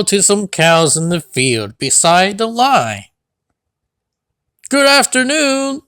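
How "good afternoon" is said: higher, sing-song hello; lightly whistled friendly hello; a hint of a happy whistle; cheerful greeting. A narrator's reading. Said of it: "he whistled to some cows".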